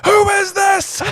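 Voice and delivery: very high, loud yell back; Vernon. Acted. Uncle answering a bellowing caller, yelling back. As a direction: holler furiously into the receiver. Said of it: "Vernon yells back".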